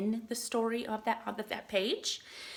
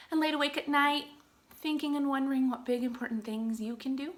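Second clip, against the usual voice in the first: ordinary delivery, nothing marked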